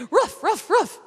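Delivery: high loud ruff ruff ruff; dog bark. Shown as a demonstration. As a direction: bark along with the class. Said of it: "let's try to do it together".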